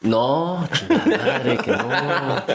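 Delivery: high-pitched, laughing exasperation; laughing protest. Real, not acted. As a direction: protest in mock dismay through laughter. Mock protest at being teased.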